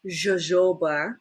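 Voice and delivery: jojoba slowly as "zho-ZHO-bah"; careful tentative pronunciation. Real, not acted. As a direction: try out jojoba slowly, with zh sounds and a rising end. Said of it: "I never knew how to pronounce it".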